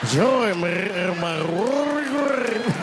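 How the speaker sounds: slow, loud gurgling ghoul voice, throat rolled; rolled-throat spooky moan; throaty ghost. Shown as a demonstration. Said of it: "you gotta roll your throat".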